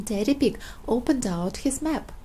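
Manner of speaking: usual speaking voice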